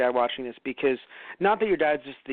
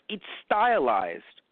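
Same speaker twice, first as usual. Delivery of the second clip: slow emphatic retort; 3 semitones higher, much slower than usual. Retold or imitated. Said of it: "I'm like".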